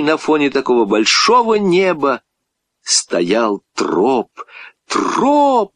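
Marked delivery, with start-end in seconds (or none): 4.9-5.7 high, sharp call to a dog; boy's shouted call. A narrator's reading. Boy calling his dog from the shore, shouted call. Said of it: "закричал мальчик".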